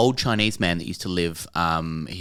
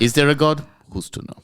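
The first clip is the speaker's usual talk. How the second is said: Polish priest, higher then hushed; Polish accent; 7 semitones higher, 3 dB louder than usual. Acted. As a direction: ask it in a Polish accent, fading to a whisper. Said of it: "do a bit of accent work".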